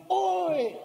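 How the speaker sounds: high call bouncing back from a ravine; imitated echo, high and drawn out; echo answering back. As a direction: imitate a far-off echo of a shout. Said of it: "dari balik jurang itu ada yang menyambut".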